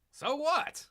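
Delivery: high dismissive so what; squeaky scoffing so what; sarcastic retort. Acted. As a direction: toss it off mockingly. Sarcastic retort to plot leak.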